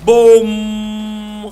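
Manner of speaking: long loud high boom sound; drawn-out mock explosion; long booming bum. Real, not acted. Celebrating a big hit.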